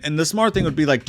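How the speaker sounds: ordinary talk, nothing marked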